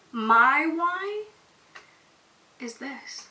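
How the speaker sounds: real emotion welling up, halting; slow down and falter with feeling; getting emotional. Real, not acted. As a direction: choke up as you reach your reason. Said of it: "I just got a little emotional".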